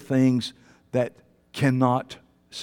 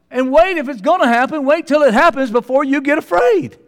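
loud, high rising sermon; fervent preaching; 9 semitones higher, 6 dB louder than usual. Acted. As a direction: challenge the fearful, building. Preaching against fear.

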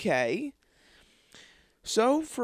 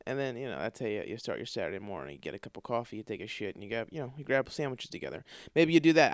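normal talking voice

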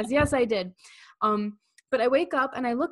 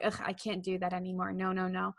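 dismissive groan; 4 semitones lower, 6 dB quieter than usual. Retold or imitated. Mimics listener's dismissive groan.

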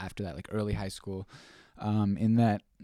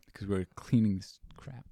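ordinary talk, nothing marked

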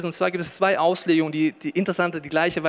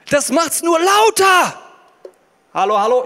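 amplified loud voice; 10 semitones higher, 7 dB louder than usual. Shown as a demonstration. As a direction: boom it out as if amplified. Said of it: "ich verstärke mal kurz".

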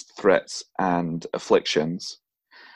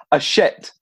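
indignant retort; 7 semitones higher, 5 dB louder than usual. Pretend. Retort to voice in bathroom.

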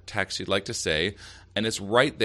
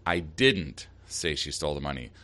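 "didn't" punched with a falling pitch, pause after it; stress on DIDN'T; 4 semitones lower than usual. Shown as a demonstration. Host giving an example of shifting meaning, stress on "didn't". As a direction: hit "didn't", break, then finish evenly. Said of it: "emphasizing one word in that sentence".

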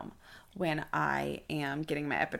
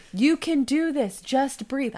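louder, slow, firm encouragement; coaching nurse; 7 semitones higher, 7 dB louder, slower than usual. Retold or imitated. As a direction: coach her through it. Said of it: "She goes".

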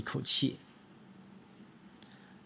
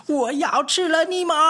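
much higher, louder monster roar; scariest roar; 8 dB louder than usual. Acted. Fat cat roaring at the mice, trying to scare them. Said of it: "最可怕的声音叫了起来".

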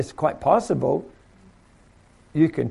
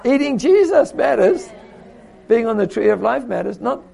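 fervent rebuttal; 6 semitones higher, 3 dB louder than usual. Retold or imitated. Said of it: "He said".

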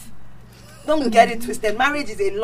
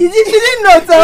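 mock protest; 8 semitones higher, 12 dB louder than usual. Mock protest in English argument.

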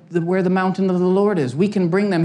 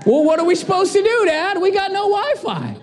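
put-on whiny kid; 13 semitones higher than usual. Kids complaining about no Wi-Fi.

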